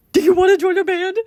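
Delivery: high, loud comic blurt; blurted invitation. Acted. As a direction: blurt it out squeakily. Acting nervous blurted invitation.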